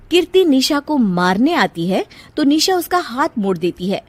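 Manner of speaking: ordinary delivery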